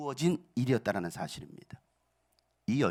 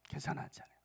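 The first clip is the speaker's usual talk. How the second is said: softer repeat; 13 dB quieter, faster than usual. Shown as a demonstration. Pastor repeats the point to the church, much softer. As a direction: echo the line much softer, almost to yourself.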